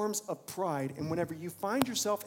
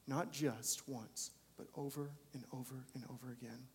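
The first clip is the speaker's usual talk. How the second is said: quiet, tender, slowing sermon close; soft closing appeal; 3 semitones lower, 7 dB quieter than usual. Acted. Preacher closing the sermon on God's mercy: hushed tender appeal. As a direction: draw them in gently.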